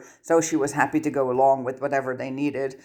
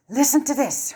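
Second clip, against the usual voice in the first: through gritted teeth; 11 semitones higher than usual. Acted. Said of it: "Hermione said through gritted teeth".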